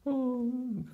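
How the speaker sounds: high, soft whining moan; imitated whine. Shown as a demonstration. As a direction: whimper to show what crying means. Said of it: "in English you say crying for".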